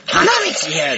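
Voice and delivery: very high, loud shout of a name; anime character impression. Shown as a demonstration. Comic repeating an anime catchphrase on request, high shouted impression. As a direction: belt out a piercing cartoon call. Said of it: "もう一回言ってください".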